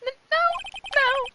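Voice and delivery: high panicked protest; panicked high cry. Acted. Character cries out in panic.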